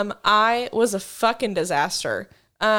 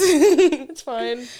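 laughing; 6 semitones higher, 8 dB louder than usual. Pretend. Joking everything's fine while on edge.

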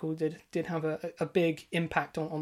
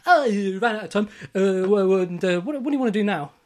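louder, mock game show host voice; gloating quiz host; 6 dB louder than usual. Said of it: "He's like".

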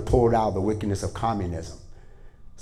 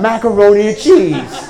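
much higher and louder, a fed-up shout of the answer; exasperated wife; 8 semitones higher, 9 dB louder than usual. Retold or imitated. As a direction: yell it like you've said it twice already. Wife repeats answer third time.

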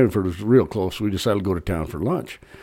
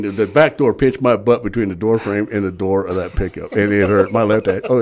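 ordinary delivery

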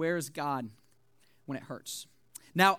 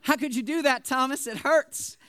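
playfully wounded, higher-pitched protest; mock hurt; 5 semitones higher, 4 dB louder than usual. Preacher teasing Thomas over his gloating text, mock-wounded. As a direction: plead in mock pain, pitch raised. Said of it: "the pain that this caused me".

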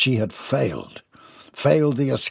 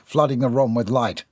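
plain reading voice

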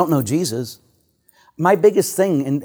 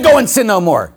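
"Go and sin no more" said louder and higher, pressing hard on "Go"; forceful command; 6 semitones higher, 5 dB louder than usual. Retold or imitated. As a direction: proclaim it boldly to the crowd. Jesus's words, first telling.

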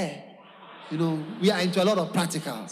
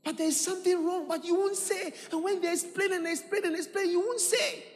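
high exasperated preaching; 9 semitones higher, 4 dB quieter than usual. Acted. Preacher rebuking stubbornness.

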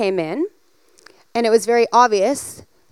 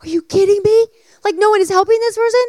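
incredulous; 8 semitones higher, 3 dB louder, faster than usual. Retold or imitated. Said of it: "I'm like".

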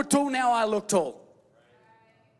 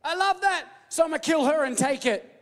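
covetous admirer; 6 semitones higher than usual. Retold or imitated. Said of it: "and be like".

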